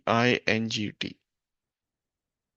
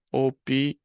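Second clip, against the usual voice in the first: syllable-by-syllable dictation. Shown as a demonstration. Teacher writing the French word for hospital, syllable-by-syllable dictation.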